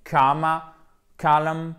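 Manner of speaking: "kama" and "column" as KOH-ma, KOH-lun; diphthong. Shown as a demonstration. Teacher illustrates the diphthong in both words, gliding O. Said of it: "à chaque fois une diphtongue".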